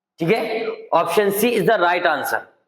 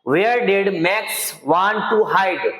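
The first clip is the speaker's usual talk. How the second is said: slow reading; much slower than usual. Shown as a demonstration. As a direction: read the English question slowly and clearly. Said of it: "teacher reads English question slowly". (धीरे-धीरे पढ़ना)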